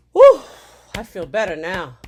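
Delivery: ordinary talk, nothing marked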